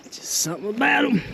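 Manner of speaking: high, fast, fond gush; swooning over the view. Real, not acted. Gushing over open pastures.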